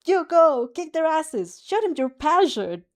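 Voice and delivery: loud, high fan cheering; cheering shout. Acted. A boy fist-pumping at the TV, yelling a cheer. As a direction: pump them up, yell at the screen.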